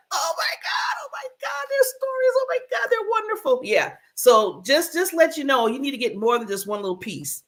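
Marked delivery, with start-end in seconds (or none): 0.1-3.6 much higher, wailing mock sob; overcome, blubbering through happy tears; mock sobbing. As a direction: ham up a sobbing fan, voice cracking high. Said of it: "you'll be doing like".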